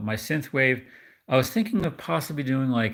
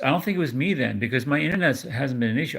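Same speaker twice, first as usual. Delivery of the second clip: normal talking voice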